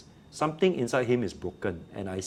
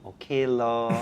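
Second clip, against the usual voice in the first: mock teacher and shrugging kid; 6 semitones higher, 5 dB louder, slower than usual. Teacher and defiant student exchange.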